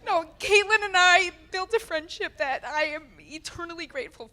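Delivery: teary, high and breaking; fighting back joyful tears; happy tears. Real, not acted. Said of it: "Which is why I'm crying".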